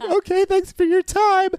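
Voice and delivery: high, loud, sarcastic chirp; sarcastic sing-song thanks; mock cheery thanks.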